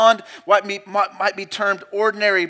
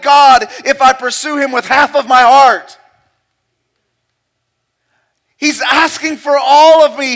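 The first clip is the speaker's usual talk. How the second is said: loud, rising sermon delivery; full-force preaching; 6 semitones higher, 11 dB louder than usual. Acted. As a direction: preach it out with mounting passion.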